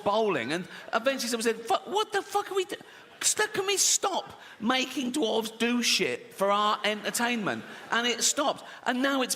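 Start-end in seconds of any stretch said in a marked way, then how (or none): 1.7-7.6 high-pitched mock appalled protest; squeaky, hammed-up dismay; exasperated objection. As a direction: plead in high exasperation for it to stop. Acting someone objecting to dwarf throwing.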